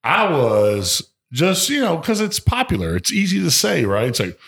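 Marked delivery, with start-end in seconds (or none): none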